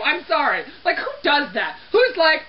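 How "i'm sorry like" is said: ordinary talk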